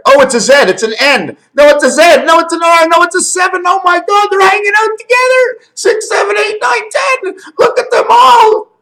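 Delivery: very high, loud excited exclamations; thrilled, voice shooting way up. Real, not acted. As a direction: squeal with amazed discovery. Excited discovery of letters in fingers.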